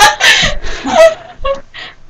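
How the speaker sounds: very high, loud shrieking laughter. Real, not acted. Cracking up at her friend's joke, loud shrieking laugh.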